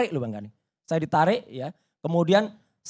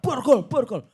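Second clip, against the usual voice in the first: mild mock yell, higher pitch; teriak; 5 semitones higher than usual. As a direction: imitate a shouted order without much force. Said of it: "Dia langsung teriak". (meniru teriakan, tidak terlalu keras)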